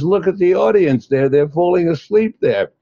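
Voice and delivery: put-on old man loudly scolding the speaker before the audience. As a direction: tell him off in front of everyone.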